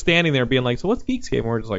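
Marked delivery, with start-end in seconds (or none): 0.8-1.4 high, soft puzzled voice; imitating a confused fan asking; mock clueless attendee. Attendee asking the booth what it is, high and soft.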